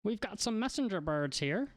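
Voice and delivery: put-on high-pitched old man selling birds; high old shopkeeper voice. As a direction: offer wares in a high old-fellow voice.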